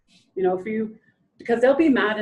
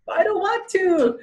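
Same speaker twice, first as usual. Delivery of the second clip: mock whiny child; 8 semitones higher, slower than usual. Child refusing to sit for photo.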